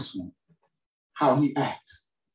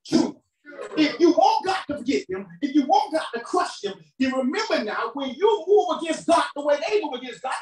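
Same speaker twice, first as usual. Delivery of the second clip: high, driving preacher's cry; full-force preaching; 11 semitones higher than usual. Real, not acted. Preacher challenging congregation on judging others, full-force preaching. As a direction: press the challenge hard in a raised pitch.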